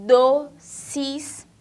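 "doces" in two loud beats, do, ces; syllables separated. Shown as a demonstration. Teacher demonstrating the word to pupils, syllables separated. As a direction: say "doces" split into do and ces, firmly. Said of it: "quando eu falo".

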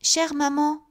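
put-on girl answers her angry mother, high and timid; stammering little girl. A narrator's reading. Little girl stammering to her mother, timid high voice. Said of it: "balbutia la petite fille".

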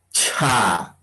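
"cha" with a puff of air after the ch; aspirated ch. Shown as a demonstration. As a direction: breathe out through the ch of "cha". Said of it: "Vamos a un poquito aspirar".

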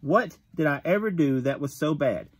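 usual speaking voice

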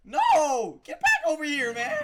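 very loud, high yelling; desperate screaming. Real, not acted. Player calling back his runaway pet, screamed in frustration.

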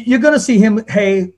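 ordinary delivery

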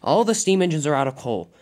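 loud, cross declaration. Acted. Diesel ten answers the other Diesels, very cross. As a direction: lay down the facts crossly and forcefully. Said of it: "said Diesel ten very crossly".

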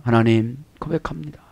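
low, soft acted confession; hushed prayer. Preacher models confessing to God, hushed prayer. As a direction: let it sink into a quiet prayer.

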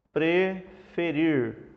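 "preferir" very slow, broken after pre; syllable by syllable. Shown as a demonstration. Teacher revealing what Ava means in Hebrew, slowly by syllables. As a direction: stretch out "preferir" in two deliberate pieces.

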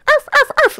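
high yappy barking; mock bark. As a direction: bark like an eager fan. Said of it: "He was making us bark".

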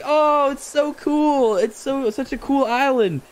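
high, slow, gushing sales talk; hyped salesman. Retold or imitated. Player mocking Nook's island pitch, high and gushing. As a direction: gush over the island to hook him. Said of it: "He's like".

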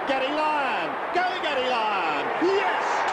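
high cheer of a goal. Real, not acted. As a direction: cheer him home.

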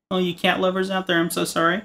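normal talking voice

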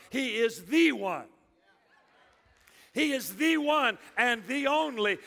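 "the" said "thee" and hit hard; stretched, stressed "the", as in the only one; emphatic THE. Real, not acted. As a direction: proclaim it, leaning hard on "the". This is not one of.

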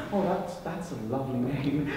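low, soft, hesitant admiration; meek compliment. Acted. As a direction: start over very gently. Meek fresh introduction, complimenting the date.

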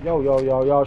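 usual speaking voice

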